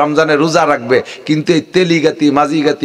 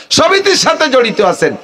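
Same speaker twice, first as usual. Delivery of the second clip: raised preaching call; 8 semitones higher than usual. Acted. Preacher calling out moneylenders in the crowd, raised high call. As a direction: repeat it higher, pushing harder. Repeats phrase, raises second.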